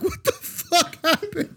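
high, slow mock shriek; mock screaming. Retelling the morning after to friends, high mock scream. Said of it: "Just screaming".

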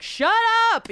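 high, loud "Shut up!"; mock yell. Telling Judi Dench off from the seats, high mock yell. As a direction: snap it at the screen. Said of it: "you're just like".

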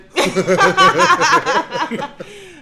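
big high cackling laugh; loud laugh. Real, not acted. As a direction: burst out laughing. Laughing at [name].

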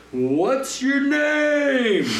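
mock shout held on one high note; imitated yell. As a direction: stretch "name" out high like a far-off call. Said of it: "until he yelled".

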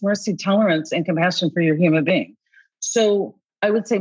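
ordinary delivery

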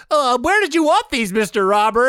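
high timid henchman voice; bumbling sidekick. Acted. Sidekick asking his boss where to put the loot. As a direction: squeak it up as a nervous sidekick. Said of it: "my sidekick comes in and he's like".

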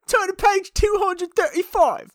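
high, slow, hammed-up British accent with a laugh; mock British teacher. Expecting a British classmate, mock British accent. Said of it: "this is me doing".

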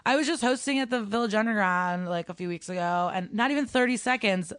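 ordinary delivery

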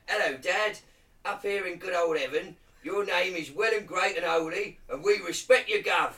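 high, loud hammed-up Cockney accent; Cockney Lord's Prayer. Reciting the Lord's Prayer to friends, loud mock Cockney. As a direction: belt out the prayer like a cheeky Cockney geezer. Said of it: "Do you wanna hear the Lord's Prayer in Cockney?".

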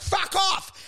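sudden high yelled outburst; mock yell. Yelling back at the bullies, mock outburst.

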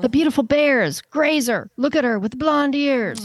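high-pitched adoring squeal of words; gushing delight. Acted. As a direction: gush over adorable animals. Gushing over the beautiful bears.

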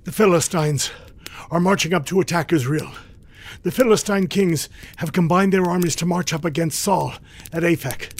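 high, urgent report; winded messenger. Acted. Soldier reporting enemy armies marching, breathless and urgent. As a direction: deliver bad news while catching breath. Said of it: "fighting to catch his breath".

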